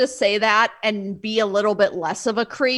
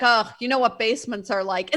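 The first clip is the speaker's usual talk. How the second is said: dismissive quote. Retold or imitated. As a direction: imitate an unbothered shrug-it-off remark. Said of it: "they were just like".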